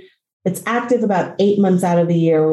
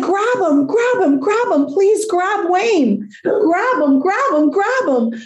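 very high, urgent repeated begging; frantic retold plea; 11 semitones higher than usual. Dog owner begging stranger to grab dog, frantic plea. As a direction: plead in a high pitch, the same words over and over.

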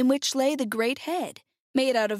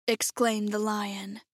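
ordinary delivery

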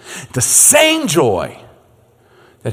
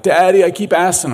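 higher-pitched imitation of a complaining young son; Caleb; 6 semitones higher, 4 dB louder than usual. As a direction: play a little boy pleading with his father. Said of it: "my son Caleb would come and be like".

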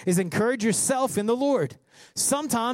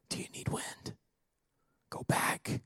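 hushed, soft whispered question and command; breath-only whisper, very soft; intimate whisper; 12 dB quieter than usual. Acted. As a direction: whisper it as a quiet prompting.

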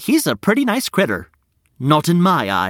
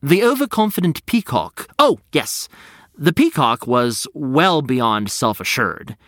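plain reading voice

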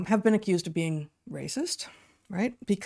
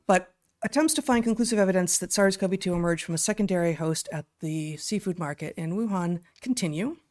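ordinary talk, nothing marked

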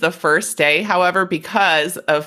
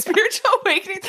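mock dreamy phrase; 13 semitones higher, much faster than usual. Mocking spiritual phrase.

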